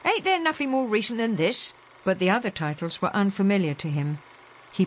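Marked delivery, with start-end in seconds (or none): 0.0-1.7 lightly voiced cockney sailor, higher; Ben's voice. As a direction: grumble it as the young sailor. Ben grumbles at the films.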